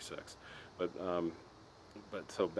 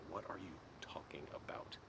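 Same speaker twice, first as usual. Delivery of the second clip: breathy incredulous whisper; whispered aside; 2 semitones higher, 9 dB quieter than usual. Real, not acted. To the viewers about dad's tailpipe claim, whispered. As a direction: drop to a disbelieving whisper. Muttered aside after the argument.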